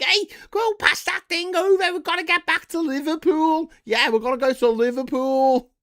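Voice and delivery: very high, loud, hammed-up Scouse accent; fake Scouse. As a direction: shout like a bad Scouse impersonator. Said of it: "Mancs who were pretending to be Scouse".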